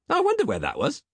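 high, suddenly perkier musing; brightening. A narrator's reading. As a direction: perk up on a pleasant discovery. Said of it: "brightening slightly".

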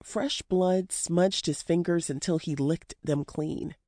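ordinary delivery, nothing marked

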